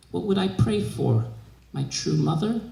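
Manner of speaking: plain reading voice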